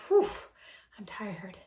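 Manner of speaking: whispered sigh of exhaustion; mock tired whisper. Imagined future self whispering tiredly. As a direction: breathe it out, worn out. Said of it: "where you're like".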